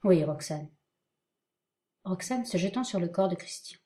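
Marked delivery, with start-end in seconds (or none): 0.0-0.7 firm, steady answer; firmly. Acted. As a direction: say it with resolve. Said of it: "Cyrano fermement".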